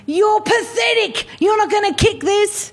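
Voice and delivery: hammed-up abusive coach voice; mock yelling coach. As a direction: jeer at the boy like a nasty opposing coach. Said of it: "the other coach was there yelling at him".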